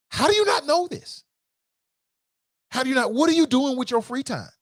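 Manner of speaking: high-pitched exasperated disbelief; incredulous. Retold or imitated. Acting own incredulous reaction to answers.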